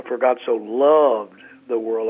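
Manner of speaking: For God so loved the world, leaning on loved; stress on loved. Shown as a demonstration. Pastor demonstrates inflection, stressing loved. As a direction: punch the word loved. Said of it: "inflect a different word every time".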